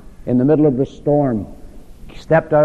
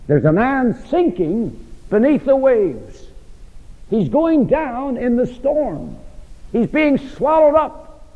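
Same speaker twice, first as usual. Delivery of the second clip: high, slow, swelling preacher's cry; rising dramatic preaching; 10 semitones higher, slower than usual. Acted. Preacher to class, dramatizing a man sinking in the storm, rising high and slow. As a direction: paint the drowning man, climbing higher.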